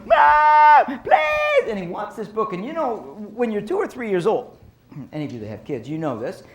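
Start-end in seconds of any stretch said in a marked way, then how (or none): 0.0-1.7 very high, loud mock tantrum wail; imitating a screaming toddler. Toddler screams at his mom for a book, high loud wail. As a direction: shriek it like a toddler begging. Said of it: "So he's screaming at his mom".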